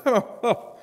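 re-enacted scornful little laugh; scoffing chortle. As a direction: chortle dismissively, as if scoffing at them. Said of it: "I chortled a bit".

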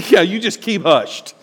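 normal talking voice

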